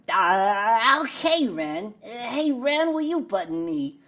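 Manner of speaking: loud, high goofy cartoon sidekick; big silly animated-character voice; Billy West cartoon voice. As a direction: whine a dopey request to your cartoon buddy. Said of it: "Billy West voices".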